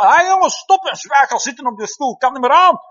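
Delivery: put-on high, loud exasperated yell at the kids; overwhelmed grandparent yelling. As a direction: yell like a worn-out grandma who can't take the noise. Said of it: "Krijg nu een kleinkind op bezoek en die begint te schreeuwen". (oververmoeide grootouder die luid en hoog naar de kleinkinderen roept)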